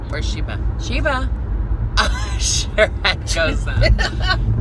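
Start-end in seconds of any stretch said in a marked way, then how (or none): 0.8-1.3 high loud call of Sheba; calling the dog. Real, not acted. 1.4-4.6 laughing. Real, not acted. Laughing aside.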